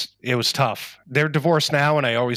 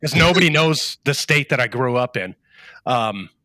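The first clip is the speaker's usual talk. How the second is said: ordinary delivery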